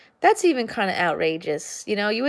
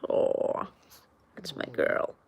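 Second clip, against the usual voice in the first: whispering; 4 semitones lower, 7 dB quieter, slower than usual. Real, not acted. Whispered to dog.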